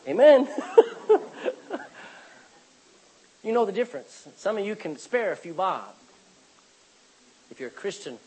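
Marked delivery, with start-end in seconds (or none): 0.5-2.1 airless, voiceless laugh; breathy laugh. Real, not acted. Preacher still laughing at his pig joke, breathy and voiceless. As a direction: laugh almost without voice. Laughter continues after amen.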